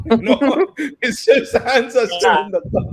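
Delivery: laughing through the words. Real, not acted. Laughing at frozen colleague.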